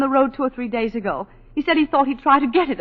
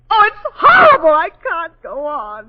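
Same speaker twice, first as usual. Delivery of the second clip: high, loud sobbing outburst, then slow crying; breaking down; 4 semitones higher, 7 dB louder, slower than usual. Acted. Wife breaking down mid-confession, sobbing. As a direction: cry out and collapse into tears.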